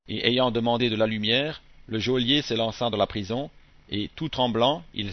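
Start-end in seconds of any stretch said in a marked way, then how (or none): none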